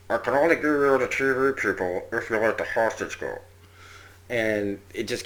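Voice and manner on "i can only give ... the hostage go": imitated bullhorn, distorted and a touch louder. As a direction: talk as if through a megaphone. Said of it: "He's, like, holding up a bullhorn".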